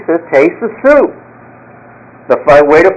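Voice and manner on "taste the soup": a joke-telling customer hamming up a demand; top of his voice. Retold or imitated. Customer insisting the waiter taste the soup, mock yell. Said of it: "at the top of his voice".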